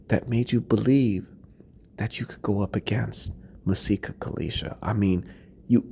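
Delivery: talking to Brittany like a counsellor at a pretend intervention, softly; mock-tender. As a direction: pretend to sit her down gently for an intervention.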